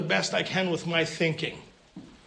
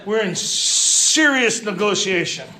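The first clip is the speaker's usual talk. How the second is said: emphatic loud; 3 semitones higher, 7 dB louder than usual. Real, not acted. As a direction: lean hard on serious negotiation.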